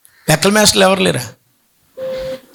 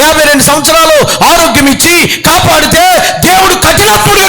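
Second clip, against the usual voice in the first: higher, forceful preaching rebuke; preaching shout; 4 semitones higher than usual. Real, not acted. Preacher challenges the ungrateful listener, high forceful rebuke. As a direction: drive the question home, full force.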